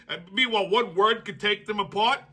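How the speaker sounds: usual speaking voice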